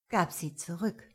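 plain reading voice

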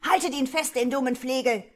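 angry crowd calling. A narrator's reading. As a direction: yell to grab the lout. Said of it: "riefen andere Stimmen".

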